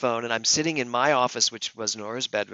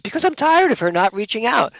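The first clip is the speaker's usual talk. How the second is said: high, loud exasperated outburst; worked-up shout, pitched way up; retold yelling; 12 semitones higher, 9 dB louder than usual. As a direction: re-enact yelling in frustration. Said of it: "why are you yelling at her".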